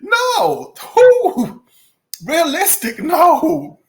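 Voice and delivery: high, loud mock disbelief; mock incredulous. Imagined listener scoffing at realism, incredulous. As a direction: scoff like it's absurd. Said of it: "He'll be like".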